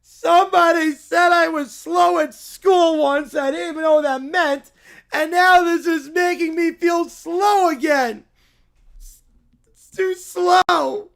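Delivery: put-on loud indignant kid rant; child ranting at the boring golf; whiny rant. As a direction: wail about it like a hurt child. Kid ranting tearful-ish.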